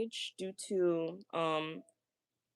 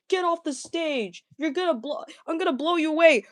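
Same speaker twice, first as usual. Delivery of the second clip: lightly put-on higher heckler voice; mock heckler; 6 semitones higher than usual. Mocking an imagined fan heckling the singer, mock heckler.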